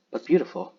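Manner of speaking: ordinary talk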